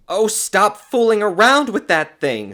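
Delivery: high, loud fussing; older woman's scolding. A narrator's reading. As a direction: brush him off impatiently. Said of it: "with the mannerisms of an older woman".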